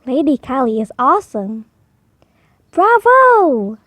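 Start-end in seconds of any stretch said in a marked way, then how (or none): 2.7-3.8 loud, high cheer of bravo; a knight cheering loudly for the harpist; yelled bravo. A narrator's reading. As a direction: cheer it out at the end of the song. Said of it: "Sir Pete yells".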